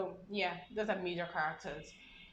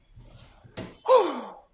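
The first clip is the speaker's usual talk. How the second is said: exasperated sigh; 8 semitones higher, 14 dB louder than usual. Shown as a demonstration. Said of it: "something like that".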